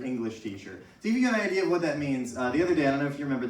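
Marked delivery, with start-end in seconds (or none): 0.0-0.8 English teacher muttered low and soft; hushed repeat of English teacher; quiet aside. Shown as a demonstration. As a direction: drop it softly as a throwaway. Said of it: "the most English teacher".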